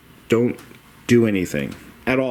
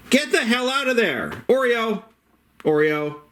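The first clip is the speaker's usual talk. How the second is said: sharp high yell at a misbehaving cat; 13 semitones higher than usual. Real, not acted. Owner yelling at cat Oreo to get out.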